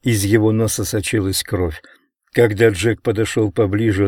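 ordinary delivery, nothing marked

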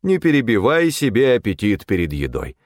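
lightly imitated stern fatherly voice; a hint of father's metallic severity. A narrator's reading. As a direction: mimic a strict father laying down a rule. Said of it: "суровым металлическим голосом отца".

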